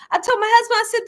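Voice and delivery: usual speaking voice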